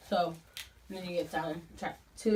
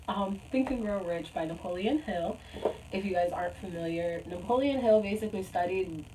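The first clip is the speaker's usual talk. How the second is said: usual speaking voice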